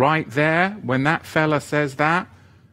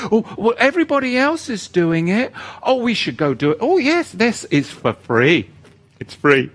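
mocking crowd voice; 9 semitones higher, 3 dB louder than usual.

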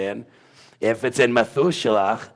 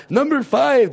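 much higher and louder mock exclamation; mock comic quote; 6 dB louder than usual. Reminding listeners of a funny guy, high mock quote. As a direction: pitch it way up, loud and playful. Quoting comedian for recognition.

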